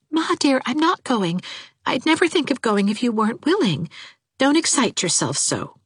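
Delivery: high-pitched, placating daughter voice; timid high voice, anxious to appease; meek daughter, soothing. A narrator's reading. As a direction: give in and calm your mother down.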